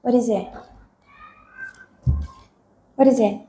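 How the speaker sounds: normal talking voice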